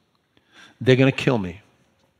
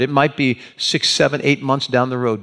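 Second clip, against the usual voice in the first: ordinary delivery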